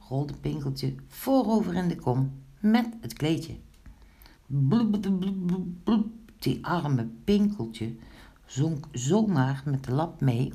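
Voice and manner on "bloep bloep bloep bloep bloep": popping underwater blubs; bubbling noises. A narrator's reading. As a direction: imitate someone going under, in short blubs. Imitates bubbles of sinking Pinkeltje. (borrelende onderwatergeluiden)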